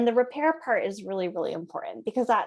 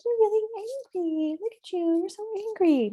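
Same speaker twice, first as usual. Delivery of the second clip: high, singsong mock sympathy; sugary baby-talk; 11 semitones higher than usual. Shown as a demonstration. Parent to a furious child, wrongly high and cutesy. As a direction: coo it in a high-pitched voice, mismatched to anger. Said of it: "we're not gonna come and be like".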